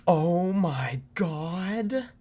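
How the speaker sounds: high, loud, stretched oh my God; shocked gasp. Real, not acted. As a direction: gasp in disbelief. Reacting to casino win video.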